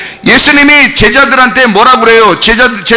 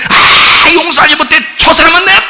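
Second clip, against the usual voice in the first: mock bitter refusal; 7 semitones higher than usual. Preacher imitating someone who refuses to forgive, higher and indignant. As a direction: play a stubborn unforgiving believer. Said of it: "어떡하려고 그래요?".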